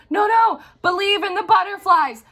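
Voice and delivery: high, loud, urging character voice; high insistent comic voice; urging movie hero. Retold or imitated. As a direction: coax her on like Adam Sandler's floating hero. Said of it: "he's like".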